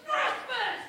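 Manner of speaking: high, strained scolding; mock hysterical complaint; acted outraged relative. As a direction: rush into a high-pitched, exasperated rebuke. Relative shrieking at Larry mid-fight.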